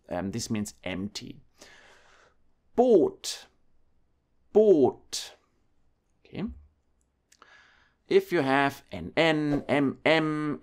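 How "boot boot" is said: high, loud Boot with a drawn-out O; long O in Boot. Shown as a demonstration. German teacher showing double vowels to learners, long O in Boot. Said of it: "make the sound a bit longer".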